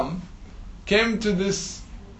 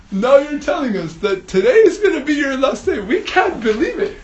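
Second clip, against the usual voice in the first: louder and higher, half laughing, half crying; envious fish blurting to its neighbour, loud and laughing; excited; 4 semitones higher, 5 dB louder than usual. Acted. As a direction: gush with laughing envy. Rui fish's tearful, laughing outburst.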